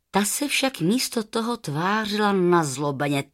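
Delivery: ordinary delivery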